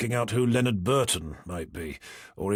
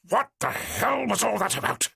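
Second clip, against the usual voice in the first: much higher, forceful demanding growl; angry demand; 11 semitones higher than usual. A narrator's reading. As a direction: round on him, demanding answers. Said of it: "he demanded, his voice a portentous growl".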